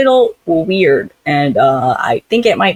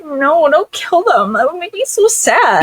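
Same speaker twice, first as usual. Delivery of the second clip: high-pitched put-on distress; mock pleading heroine; 12 semitones higher than usual. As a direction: plead high and childlike, hammed up. Voices heroine pleading for captives.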